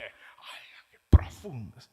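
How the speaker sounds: breathless awed whisper; mock awed whisper. Listener dazzled by the fake preacher, mock awed whisper. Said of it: "Y dice".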